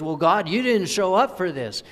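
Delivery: high, loud, indignant accusation; accusing God. Retold or imitated. People blaming God, loud indignant "you didn't show up". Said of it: "what God gets accused of".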